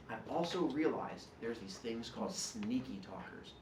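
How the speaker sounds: ordinary delivery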